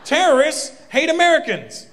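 heated rant. Acted. Escalating rant.

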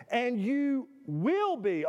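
slow, weight on "will"; emphatic. Shown as a demonstration. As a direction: stretch "And you will be" heavily. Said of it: "here's another emphatic".

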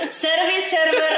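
Tukul impression. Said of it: "suara Mas Tukul".

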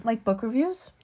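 ordinary delivery

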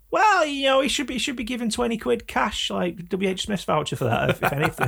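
high, pompous put-on public voice; mock reasonable citizen. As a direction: play a smug member of the public. Mocking the British public's attitude.

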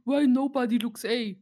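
put-on loud Russian-accented English callout; russian accent in german. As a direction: imitate a Russian gamer's accent. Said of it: "sorry hier für den russischen Akzent".

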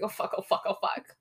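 low, soft repeated cursing; muttered panic. Retold or imitated. Bailey spots the cops at the diner, muttered mock panic. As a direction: mutter it under your breath, imitating panic.

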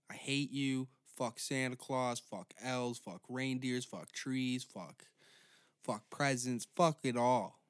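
usual speaking voice

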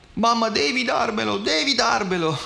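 put-on high, loud, whining child demanding a toy; screaming little girl. A narrator's reading. Toddler at the checkout demanding a toy from her mother, high tantrum wail. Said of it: "piangeva isterica, gridava".

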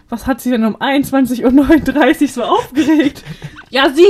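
imagined doctor question, laughing loudly; laughing through it. Retold or imitated. Joking about the doctor's next question, laughing. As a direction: giggle through the pretend dialogue.

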